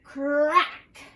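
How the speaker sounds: loud, punchy crack; dramatic crack. A narrator's reading. Narrating the egg hatching, a loud dramatic crack. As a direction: snap the word out like breaking shell.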